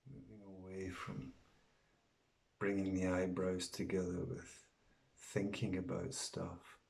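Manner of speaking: soft, unhurried meditation voice with long pauses; guided meditation. Acted. Teacher guiding listeners to breathe out and relax, soft guided meditation. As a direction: ease them quietly into relaxation, letting each phrase settle.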